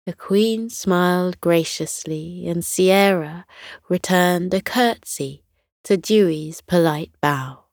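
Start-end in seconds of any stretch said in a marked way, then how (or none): none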